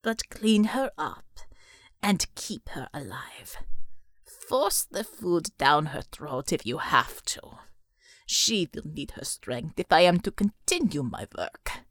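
low, slow devil rasp dropping to a whisper; slow low rasping whisper of a monster. A narrator's reading. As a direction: menace it out slowly, half whispered. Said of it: "the devil rasped".

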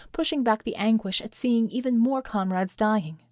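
ordinary delivery

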